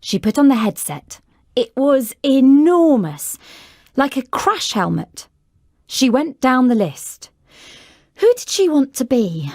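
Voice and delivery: usual speaking voice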